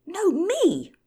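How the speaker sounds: very high squawk; squawking bird. Acted. Bird squawking its claim over the others, very high and loud. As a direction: screech it like a tiny bird. Birds quarrelling.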